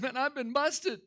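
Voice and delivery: high-pitched mock tearful admission; imitated crying. Preacher voicing the man crying his eyes out, tearful. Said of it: "he's just crying his eyes out".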